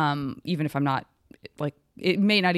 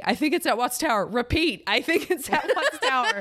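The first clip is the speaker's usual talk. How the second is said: high robot voice saying repeat; robot announcing each repeat; protocol robot; 8 semitones higher than usual. Pretend. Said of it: "I don't say".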